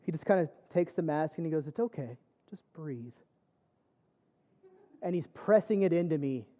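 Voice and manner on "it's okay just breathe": hushed, lower, soothing voice; gentle reassurance. Retold or imitated. Anesthesiologist calming patient with the mask, very gently. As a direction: softly coax a frightened patient to breathe. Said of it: "he was so gentle".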